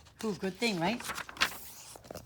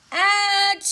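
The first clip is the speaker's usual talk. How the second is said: loud high sung-out achoo; huge bear sneeze achoo; big sneeze; 10 semitones higher, 11 dB louder than usual. Acted. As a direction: let the sneeze explode.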